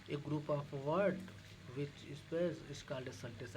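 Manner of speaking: very slow, drawn-out English definition; slow quoted English definition; slow English recital. Shown as a demonstration. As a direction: recite it word by word like a rote lesson. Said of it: "इसको English में sentence बोलते हैं".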